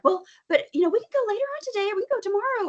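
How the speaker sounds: high, fast, bright fix-it voice; problem-solving parent. Shown as a demonstration. Parent brushing off child's feelings with quick fixes, chirpy and fast. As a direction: rush to offer a solution. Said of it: "if you're like".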